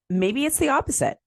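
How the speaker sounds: normal talking voice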